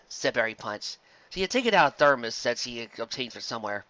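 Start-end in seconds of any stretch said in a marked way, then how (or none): none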